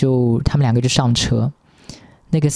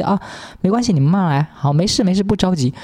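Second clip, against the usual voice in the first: higher, mock-accommodating; fawning driver; 6 semitones higher than usual. Imitating the driver now fawning over the crew. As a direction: lay on false kindness.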